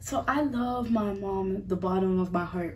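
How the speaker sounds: ordinary delivery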